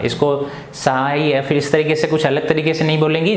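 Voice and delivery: usual speaking voice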